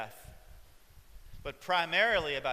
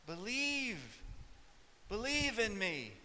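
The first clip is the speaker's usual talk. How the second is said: higher, gentle pleading reminder; Jesus reminding; 4 semitones higher than usual. Retold or imitated. Jesus to Martha, a gentle pleading reminder. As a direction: coax her to believe. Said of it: "Jesus had to remind her".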